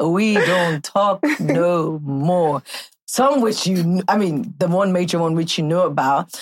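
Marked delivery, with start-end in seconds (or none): 0.0-2.6 very slow, word by word; slow emphatic repeat. Real, not acted. Host admits lost friendships to her guest, slowly and emphatically. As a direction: stretch out "We don't talk no more".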